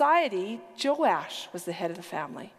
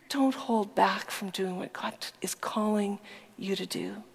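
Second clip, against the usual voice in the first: normal talking voice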